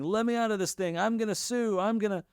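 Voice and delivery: high-pitched imitation of panicked protest; mock screaming. Teacher imitating the shocked person in the other room, high mock scream. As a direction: plead to be let out, only half screaming. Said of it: "screaming bloody murder".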